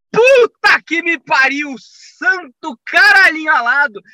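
high, loud startled outburst; startled swearing. Pretend. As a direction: jump out of your skin. Startled exclamation. (susto, palavrões agudos e altos)

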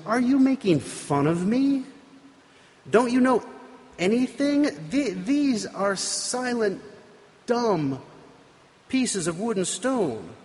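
high, scornful father's rebuke; scolding father. Acted. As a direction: scold him like an outraged father. Father scolds son over idols.

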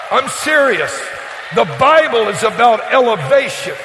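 loud, driving sermon delivery; preaching at full force. Acted. Preacher exhorting his congregation about their land, loud and driving. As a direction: keep pressing the promise home to the crowd.

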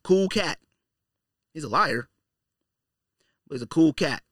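ordinary delivery

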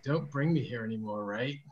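put-on low, quiet film-quote American accent; imitated American accent. Quoting a Space Jam scene for friends, low American accent. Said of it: "remember the scene in Space Jam".

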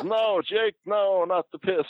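very high falsetto squeal of protest; Honky Tonk Man. Retold or imitated. Wrestler begging Jake as it spills on him, mock falsetto squeal. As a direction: imitate a panicked plea in a squeaky falsetto. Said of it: "with his voice".

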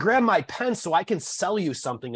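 high, mocking sales voice; derisive mimicry. Derides the caller's sales line.

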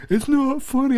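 high, mock-upset kid voice; indignant protest. Teased son objecting to parent's laughter, indignant squeal. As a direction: imitate a teased boy protesting in a high pitch.

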